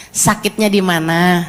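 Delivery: soft, low and slow, like comforting a hurt wife; tender question. Retold or imitated. Husband gently asking his hurting wife, soft and low. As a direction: ask gently where it hurts. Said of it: "terus bilang gini".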